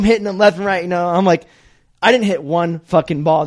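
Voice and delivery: ordinary talk, nothing marked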